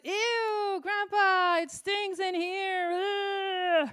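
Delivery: put-on child complaining to grandpa about the stink; disgusted child. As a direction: wail it like a grossed-out five-year-old. Child disgusted by bathroom smell.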